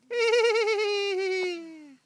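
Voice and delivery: high loud eerie cackle; creepy high laugh. Acted. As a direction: let out a shrill ghostly laugh.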